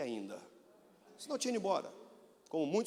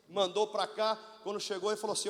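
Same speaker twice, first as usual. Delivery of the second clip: normal talking voice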